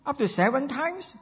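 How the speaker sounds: Peter asking. Retold or imitated. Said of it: "Peter is asking Jesus".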